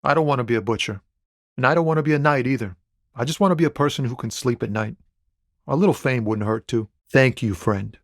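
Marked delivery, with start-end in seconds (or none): none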